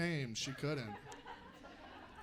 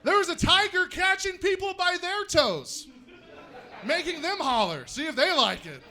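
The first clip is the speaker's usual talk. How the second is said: hyped-up zoo report; 9 semitones higher, 5 dB louder than usual. Acted.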